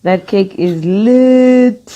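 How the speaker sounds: slow, drawn-out rave; raving. Real, not acted. Co-host praising yesterday's cake, drawn-out rave. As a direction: stretch out LIT with relish.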